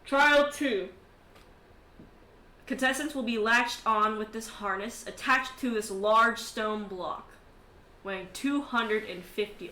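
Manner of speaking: measured, projected trial announcement; loud opening, deliberate announcer delivery; arena announcer. Acted. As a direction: announce the contest rules to a crowd. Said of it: "The guy's like".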